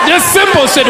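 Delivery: ordinary talk, nothing marked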